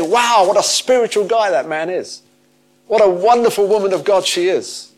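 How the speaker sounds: high-pitched, wowed gushing; hammed-up awe at someone's greatness; gushing admiration. People admiring a spiritual man or woman, gushing.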